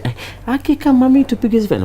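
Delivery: imitated fan in a very high voice; excited street fan. Passer-by greeting the comedian in the street, high-pitched excited imitation. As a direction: call out high to a celebrity you spotted. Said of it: "people say".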